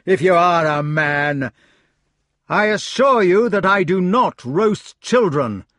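loud, high exasperated pleading; exasperated knight. Acted. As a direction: reason with an angry fool. Lancelot pleads from tree.

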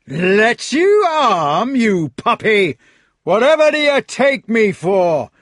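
loud, high, slow contemptuous roar; furious bellow. Acted. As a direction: sneer and bellow at him. Fat knight rages.

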